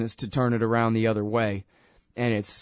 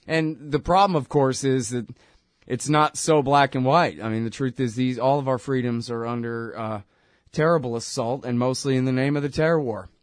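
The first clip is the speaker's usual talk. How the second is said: normal talking voice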